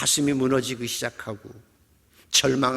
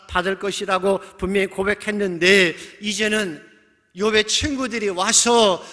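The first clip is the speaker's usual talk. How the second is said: loud, raised sermon delivery; preaching at full force; 6 semitones higher, 8 dB louder than usual. Real, not acted. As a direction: drive the story forward in a high, forceful voice. (높고 힘찬 설교조)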